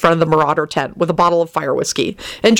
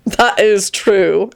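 slow, drawn-out theatrical sighing; 3 semitones higher, much slower than usual. A narrator's reading. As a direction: sigh it out theatrically, very slowly. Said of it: "Antonin sighed theatrically".

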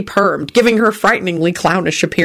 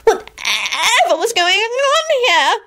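high slow petulant whine; petulant outburst; much slower than usual. A narrator's reading. Loretta demanding of the room, high petulant whine. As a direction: sulk it out like a spoiled child. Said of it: "Loretta burst out petulantly".

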